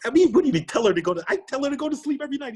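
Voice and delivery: high-pitched incredulous protest. Retold or imitated. Exhausted father to his adviser friend, incredulous. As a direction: throw the advice back in disbelief. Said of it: "And I looked at him like, "Are you kidding me?".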